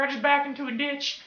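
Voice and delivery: high put-on old lady voice. Old lady reporting her accident to the cashier, high-pitched put-on voice. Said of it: "not gonna talk like that 'cause it's kinda hard".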